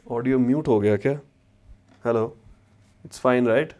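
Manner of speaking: ordinary delivery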